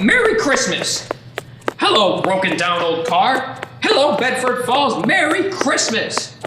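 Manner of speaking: high, slow, elated shouts; overjoyed Christmas greetings; joyful calling out. Acted. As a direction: greet the whole town with joy. Joyful return to life.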